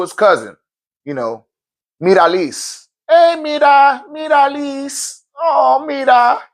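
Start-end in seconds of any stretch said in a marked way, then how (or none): 3.1-6.4 drawn-out, higher sing-song call of her name; slow, stretched teasing greeting. Pretend. Greeting the cousin by name, slow and sing-song higher.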